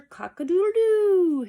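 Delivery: very high, loud, slow cock-a-doodle-doo; rooster crow. A narrator's reading. Teacher reading Mister Brown to kids, loud high rooster crow. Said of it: "He can sound like a rooster".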